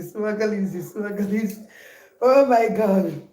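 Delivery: normal talking voice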